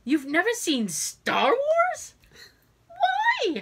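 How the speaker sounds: climbing to a high incredulous squeal; shocked disbelief. Retold or imitated. Patient shocked that the therapist never saw Star Wars, incredulous squeal. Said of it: "my jaw dropped".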